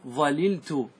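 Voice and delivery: ظللت said "ẓaliltu"; both lams sounded. Shown as a demonstration. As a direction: say ظللت in full with two l sounds. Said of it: "وتأتي باللامين".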